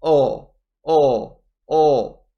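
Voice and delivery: "Oh" as a held dark L, "ull"; isolated L sound. Shown as a demonstration. Teacher demonstrating the letter L, isolated sound. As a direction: repeat "Oh" as "ull", tongue behind the teeth. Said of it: "how do I pronounce L?".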